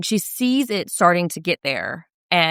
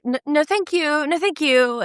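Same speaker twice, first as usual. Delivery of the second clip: prissy, raised no thank you; high polite refusal; 8 semitones higher than usual. Retold or imitated. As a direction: turn him down politely, high. Acting girl refusing massage.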